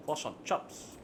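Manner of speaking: mock Kiwi "fish and chips" as "fuss on chops"; exaggerated New Zealand pronunciation; New Zealand accent. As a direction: tease the Kiwi vowels. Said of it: "New Zealanders, I speak with a fuss on chop".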